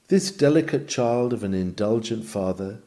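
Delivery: normal talking voice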